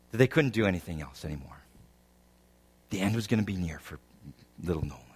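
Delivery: low, soft, subdued telling with long pauses; somber sermon story. Real, not acted. Preacher tells congregation of little Nolan's cancer, somber and hushed. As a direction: tell it gently and low, leaving space between phrases.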